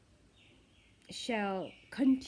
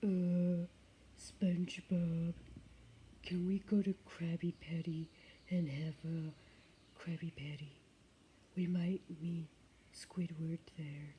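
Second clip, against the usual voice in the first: low, slow dopey starfish voice; Patrick voice; 4 semitones lower, 5 dB quieter than usual. Retold or imitated. Vlogger doing a cartoon starfish for viewers, low and dopey. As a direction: sink low and slow like a dim-witted starfish. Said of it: "see if I can do my Patrick".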